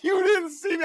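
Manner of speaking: high, half-laughing yell of disbelief; 높은 목소리로 어이없어하며 외치는 말; yelling in the street. Retold or imitated. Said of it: "I'm yelling in the street".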